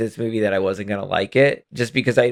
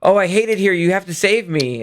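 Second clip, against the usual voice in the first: higher-pitched mock damsel plea; bad acting; 6 semitones higher than usual. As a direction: plead like a bad actress, pitched up. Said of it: "bad acting to him of like".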